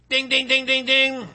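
high, steady run of dings, lightly voiced; ding like an alert bell; imitated bell. Imitates an alarm bell mid-reading.

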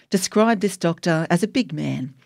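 usual speaking voice

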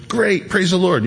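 bright, high put-on cheer; forced happy church answer; fake cheerful. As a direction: pretend everything is fine. Fake cheerful answer, then "lying.

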